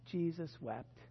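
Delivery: softly, letting it fall away; quiet, reverent and sober; solemn hush. Real, not acted. Preacher quoting the shortest verse to listeners, solemn and hushed.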